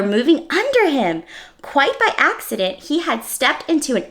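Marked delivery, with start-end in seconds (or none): none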